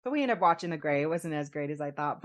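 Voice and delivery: ordinary delivery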